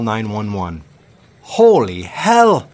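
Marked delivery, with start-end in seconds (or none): 1.4-2.7 loud, high, slow shocked yell. Acted. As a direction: shout down from the ferry in alarm. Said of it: "she yelled down".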